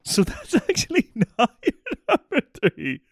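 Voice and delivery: high, laughing incredulous outburst; laughing disbelief. Real, not acted. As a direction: laugh through it at your friend's blunder. Friend laughing at panicked answer.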